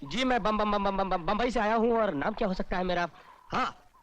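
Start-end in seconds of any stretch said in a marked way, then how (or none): 0.0-2.0 stuck on the first syllable; flustered answer under questioning; nervous stutter. Acted. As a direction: stutter repeatedly, then burst out the word.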